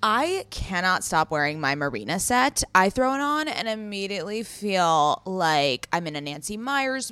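loud, enthusiastic sponsor read. Acted. Host pitching a clothing brand to listeners, punchy ad read. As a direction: sell it with punchy emphasis.

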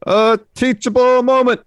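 high, loud, drawn-out catchphrase; Chase. Retold or imitated. Chase lecturing Waller on the mic, proclaiming. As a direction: call it out like a crowd chant. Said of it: "he tells Waller that this is what?".

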